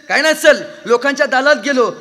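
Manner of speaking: high, loud, R as L in "सर"; mimicked speech defect, L for R in "दालात"; lisping boy. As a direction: say "सर" as "sal" and "दालात" for doorway, loudly. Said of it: "ते बोबडं बोलणारं".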